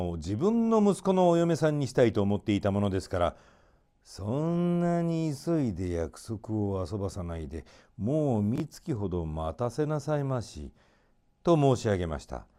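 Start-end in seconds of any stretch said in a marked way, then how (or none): 4.0-10.7 slow, softer voice of a scheming minister; sly minister. Acted. Minister stalling the king for his own son, slow and sly. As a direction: hold the king back, slowly and softly.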